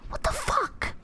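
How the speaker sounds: usual speaking voice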